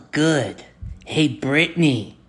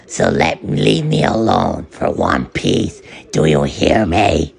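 mock deep threat; 11 semitones lower than usual. Chipoko threatening the waiter in a hammed-up deep voice. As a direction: drop into a menacing deep voice.

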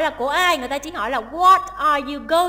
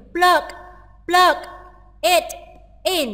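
PLUG, IT and IN each said apart, with pauses between; word by word; 4 semitones higher, 4 dB louder, slower than usual. Shown as a demonstration. Teacher breaking down "plug it in" for students, word by word. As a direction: say "plug", "it", "in" as separate, unlinked words. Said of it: "người ta sẽ đọc nối âm là".

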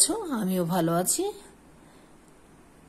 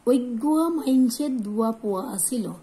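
higher Chittagong dialect sentence "অজ্ঞা মাইনসের দুয়া পোয়া আছিল", with a bright local lilt; regional dialect; 4 semitones higher, 4 dB louder than usual. Shown as a demonstration. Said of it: "এইভাবে না বলে". (চট্টগ্রামের আঞ্চলিক ভাষায় উঁচু সুরে বলা)

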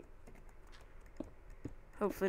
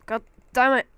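angry; 5 semitones higher, 9 dB louder than usual. Real, not acted. Stuck in the hole, frustrated.

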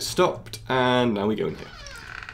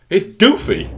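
higher, louder gleeful cry; excited; 8 semitones higher, 7 dB louder, slower than usual. Real, not acted. Gamer recognising the character, gleefully. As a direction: shout it out delighted.

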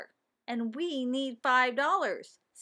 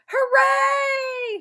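high, loud drawn-out cheer; excited shout; 13 dB louder than usual. A narrator's reading. Class cheers the news of a class pet, excited shout. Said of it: "shouted the class".

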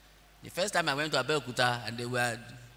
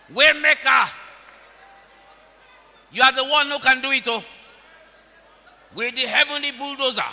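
loud, fast, high prayer; praying aloud in fervor; fervent prayer; 9 semitones higher, 7 dB louder than usual. Real, not acted. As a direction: pour it out loudly.

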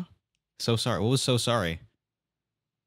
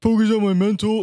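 high, loud mocking parrot of the phrase; mocking echo; 9 semitones higher, 8 dB louder than usual. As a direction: sneer it back in a high, loud mock voice. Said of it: "they all say the same shit".

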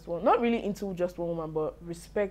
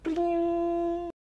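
high sung bling, far higher; sound effect bling; 11 semitones higher than usual. Pretend. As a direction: chime out a bright bling like a sparkle. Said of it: "the future in Michael just unlocked".

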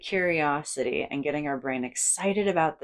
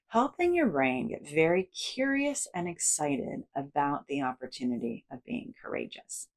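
usual speaking voice

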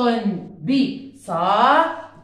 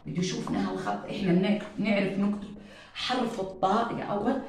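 softer teacher voice; hushed; 2 semitones lower, 9 dB quieter, faster than usual. Real, not acted. (صوت هامس للأطفال)

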